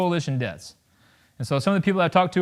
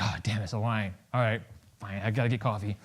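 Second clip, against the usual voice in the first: low, quiet, put-upon aside; muted, reluctant muttering; resigned grumble; 7 semitones lower, 8 dB quieter than usual. Acted. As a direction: grumble softly to yourself, giving in. Inner grumble at Starbucks line.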